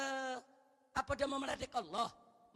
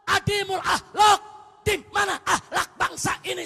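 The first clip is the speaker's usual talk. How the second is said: loud, higher, shouted sermon at full force; fiery preaching; 6 semitones higher, 18 dB louder than usual. Acted. Preacher rebuking the congregation over shameless sin, fiery shouting. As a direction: thunder at the congregation in outrage. Preacher rails at immoral boasting.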